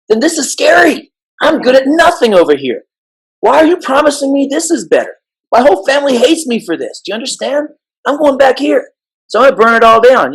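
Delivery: high-pitched acted protest of an overwhelmed kid; scared kid. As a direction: plead as a frightened kid, pitched well up. Voicing a scared kid resisting change.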